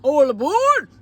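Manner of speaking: booming all aboard call; train conductor call. Pretend. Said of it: "train conductor".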